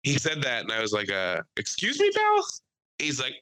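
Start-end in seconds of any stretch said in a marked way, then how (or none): 1.6-2.5 very high, rising challenge; comic incredulous question; mock affronted. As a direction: act taken aback, pitch leaping up on the last word. Retold challenge to man at bar.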